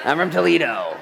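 high, loud comic outburst; defiant mock yell. Excuse-maker blaming his hometown, loud mock defiance. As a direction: belt it out as a proud excuse.

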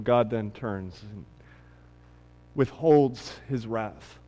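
ordinary talk

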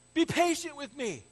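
high, loud, hammed-up plea; mock pleading. Debtor to king about ten billion, loudly pleading.

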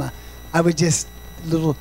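ordinary delivery

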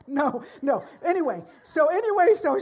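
loud, high laughing no, no. Real, not acted. Preacher catching herself after joke, laughing loudly. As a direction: shake off the joke mid-laugh.

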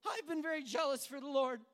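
high, loud self-justifying protest; frustrated. Acted. Elijah defending himself to God, loud frustration. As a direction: protest loudly in your own defense. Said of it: "when we're frustrated with God".

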